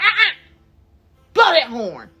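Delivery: loud, high, stern uh-uh; firm command. Retold or imitated. Instructor stopping the student from moving, loud and firm. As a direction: stop her with a sharp call.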